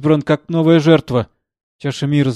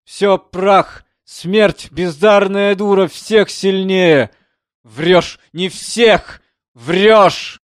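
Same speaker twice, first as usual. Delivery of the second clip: high, loud raging outcry; furious shout; 6 semitones higher, 6 dB louder, much slower than usual. Acted. Composer screaming at death, furious roar. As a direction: rage at death with full voice. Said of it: "не ей кричал".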